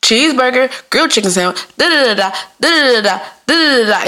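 rattled-off order calls in a lilting chant; repetitive, animated. Acted. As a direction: reel off orders on repeat. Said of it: "sounds like I'm repetitive or, or animated".

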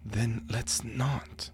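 weary and resigned. Acted. Two agents doubting their mission.

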